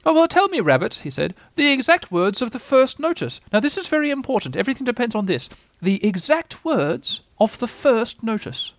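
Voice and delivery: high-pitched owl voice, insistent; self-important Owl. Acted. Owl questions Rabbit about the first notice, high and insistent. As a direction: stress how very important it is.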